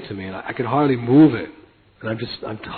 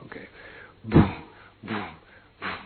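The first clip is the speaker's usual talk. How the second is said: ordinary talk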